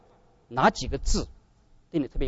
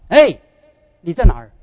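God calling out; 9 dB louder than usual. Acted. God calls to the straying believer through the verse, a loud summoning call. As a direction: call out to someone hiding.

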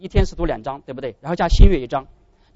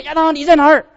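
loud, much higher calling voice; God calling Adam; 10 semitones higher, 8 dB louder than usual. Acted. God seeks hiding Adam after the sin, a loud high call. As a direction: call out across the garden to find him.

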